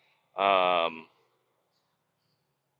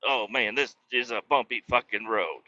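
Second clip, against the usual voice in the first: ordinary talk, nothing marked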